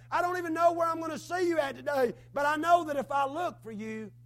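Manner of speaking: loud, rising prayer-preaching, peaking on the plea; fervent preaching. Acted. Preacher reliving his desperate prayer before the church, in loud fervent preaching.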